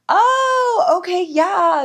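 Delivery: high, drawn-out oh of realization; acted recognition. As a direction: act as if it suddenly clicks. Acts out recognizing the coach.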